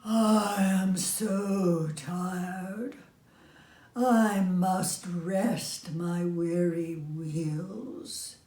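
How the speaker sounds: very slow, sighing, worn-out engine voice; the rusty engine refusing, too tired to go on; weary old engine. Acted. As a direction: drag each word out like an exhausted old machine. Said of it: "But the rusty old engine sighed".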